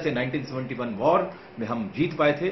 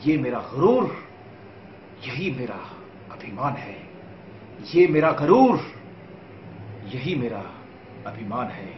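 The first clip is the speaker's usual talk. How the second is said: dramatic poem recitation. Acted. Son of Bihar declares his pride to viewers, dramatic recitation. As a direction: punch the opening phrases, pause, let the endings fall.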